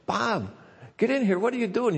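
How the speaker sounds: high-pitched mock yell, no real volume; retold yell. Wife calling Bob in from the parking lot, high mock yell. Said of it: "and she yells".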